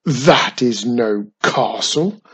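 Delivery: a touch of mocking amusement; amused scoff. A narrator's reading. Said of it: "Fortress laughed".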